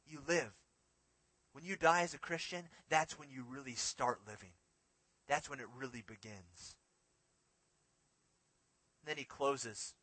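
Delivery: ordinary talk, nothing marked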